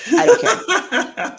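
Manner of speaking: laughing it off, drawn out and high; laughing and stretching it out. Real, not acted. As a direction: giggle through the words, slow. Jokingly owning attention-seeking.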